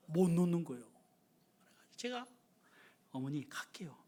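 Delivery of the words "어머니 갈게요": low, soft unvoiced whisper. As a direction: murmur your promise. Whispering promise to mother. (낮게 속삭이는 약속)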